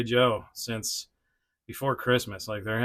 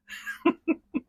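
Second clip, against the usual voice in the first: high breathy laugh. Real, not acted. Laughs at the pose.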